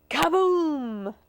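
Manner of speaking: loud booming "kaboom"; big comic explosion; explosive crash. A narrator's reading. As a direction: burst out with a crash sound. Saucer crashes.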